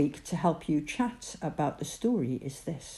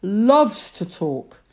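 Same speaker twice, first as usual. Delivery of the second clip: God's voice; 9 dB louder than usual. Acted. God reassures Moses.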